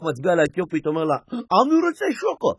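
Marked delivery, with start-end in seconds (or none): 1.3-2.5 put-on high, slow baby demand; baby asks high and slow for chocolate milk. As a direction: whine it like a toddler.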